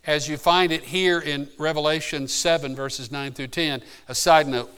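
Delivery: ordinary talk, nothing marked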